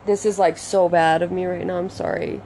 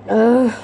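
frustrated ugh; 3 semitones higher, 5 dB louder than usual. Real, not acted. Frustrated groan over lost pen.

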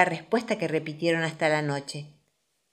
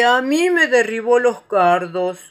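slow, loud, higher moan; complaining Igor; 5 semitones higher, 9 dB louder, slower than usual. A narrator's reading. Igor complaining of knocked-down thistles, aggrieved. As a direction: draw out the grievance.

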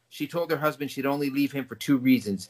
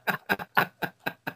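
laugh; 6 semitones higher, 4 dB quieter than usual. Real, not acted. Reacting to message.

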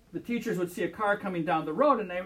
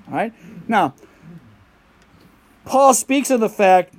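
loud, fervent sermon voice, much louder; full-force preaching; 12 dB louder than usual. Acted. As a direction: preach it out to the congregation.

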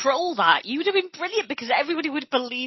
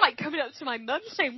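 usual speaking voice

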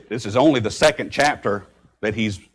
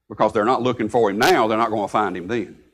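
normal talking voice

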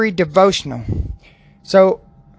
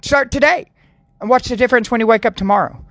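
normal talking voice

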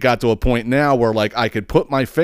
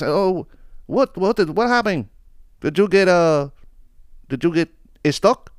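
higher, lightly voiced worried stranger; imitated puzzled neighbor; 7 semitones higher than usual. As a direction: ask it like a confused passerby. Neighbor asks what happened.

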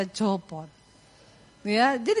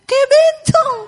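very high, loud comic screech; mock horrified yell; 11 dB louder, slower than usual. Husband reacting to wife's hair extensions, horrified yell. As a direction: shriek it in exaggerated horror. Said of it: "dia teriak".

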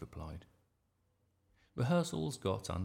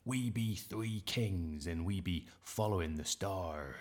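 put-on slow, drawn-out pirate accent. A narrator's reading. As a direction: declaim it like a play-acting pirate, slowly. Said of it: "in his best pirate accent".

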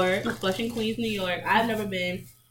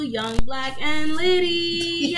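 loud, drawn-out show title; show name shout-out; 7 semitones higher, 7 dB louder, much slower than usual. Acted.

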